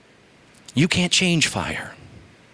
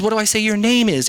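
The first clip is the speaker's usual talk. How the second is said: raised, questioning plea; Moses asking God's name; 8 semitones higher than usual. Acted. Moses asking God his name, raised plea. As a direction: ask it up high and pleading. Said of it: "He says".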